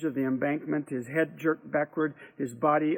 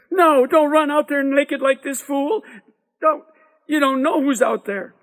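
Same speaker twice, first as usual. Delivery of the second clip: high, loud frantic warning; alarmed warning; 11 semitones higher, 9 dB louder than usual. A narrator's reading. Boy stopping his naked friend running out, high alarmed shout. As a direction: shout him back, urgently.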